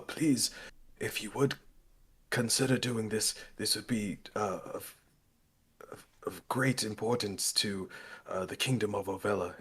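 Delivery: soft, slightly halting formal plea; earnest departing petitioner. Acted. Abernathy asks the group to consider the job, soft earnest plea. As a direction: implore them quietly and courteously.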